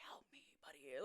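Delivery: very soft, low whispered plea; nonchalant whisper. Retold or imitated. Hurt player trying to hide distress, nonchalant whisper. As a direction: whisper it as if it's no big deal. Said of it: "I'm trying to be nonchalant about it".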